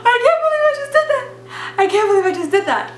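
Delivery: high, tearful wail in a rush; mock crying. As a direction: imitate a panicked, sobbing blurt. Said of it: "I'm just, like, crying".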